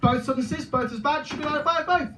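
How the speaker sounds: arguing with a guy. Retold or imitated. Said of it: "I was like".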